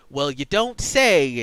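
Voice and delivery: slow, loud southern drawl; country accent. Shown as a demonstration. Amiri voiced in a Southern country accent. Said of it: "she would have, like, a".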